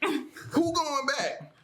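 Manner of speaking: very high, slow, drawn-out who going back; incredulous scoff. Real, not acted. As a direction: stretch it out in mock disbelief. Mocking idea of returning.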